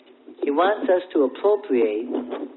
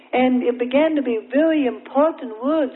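ordinary talk

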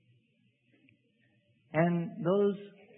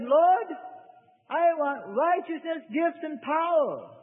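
high, slow, grasping plea for power; demanding prayer; 10 semitones higher, slower than usual. Retold or imitated. Preacher mimics a believer demanding gifts and power, high and slow. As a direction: pray like you're owed it.

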